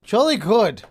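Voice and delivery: loud, high hammed-up British toff; overly polite British backpedalling; mock British accent. Player turns British before the big umpire, mock British accent.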